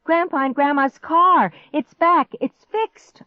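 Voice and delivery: high, loud, climbing joy; excited shout. A narrator's reading. Girl hears the car start, shouting excitedly. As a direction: shout it with growing relief. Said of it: "I shouted".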